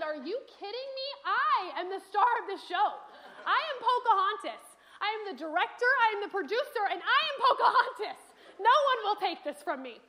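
high, loud, laughing tantrum of a little diva; indignant child. Retold or imitated. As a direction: protest like a child losing her role, half-laughing.

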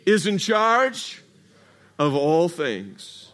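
drawn out, each word spaced; slow preacher's chant with the crowd; slow call and response. Real, not acted. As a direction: lead the congregation slowly through it. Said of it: "Say it with me".

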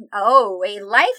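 normal talking voice

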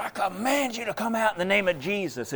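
high, loud order in the name of Jesus; commanding cry. Retold or imitated. Father ordering the demon out of his child, high forceful command. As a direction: command the spirit out.

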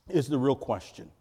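ordinary delivery

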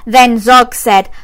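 plain reading voice

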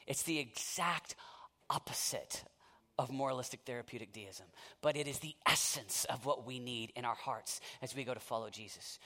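quiet reverent preaching; hushed sermon. Acted. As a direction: drop to a hush after the climax. Sudden hush after climax.

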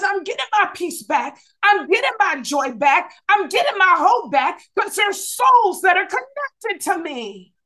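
preacher shouting the believer's vow. Acted. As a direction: claim it back with fire. Preacher voicing congregant's declaration.

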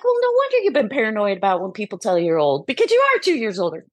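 quick, teasing reasoning; fast teasing. Retold or imitated. Daughter teasing her mom, fast. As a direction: rattle it off fast, needling. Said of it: "I'm like".

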